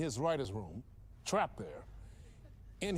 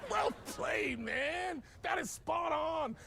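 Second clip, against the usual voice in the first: higher, slow and emphatic shouted praise; enthusiastic praise; 9 semitones higher, much slower than usual. Acted. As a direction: cheer the guest on. Host praises guest's supposed joke.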